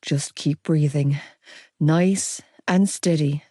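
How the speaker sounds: slow low calming coach voice; soothing. A narrator's reading. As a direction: talk her through it, slow and gentle. Joe soothing her in labour.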